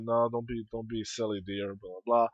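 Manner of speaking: mom brushing him off. Retold or imitated. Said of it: "And she's like".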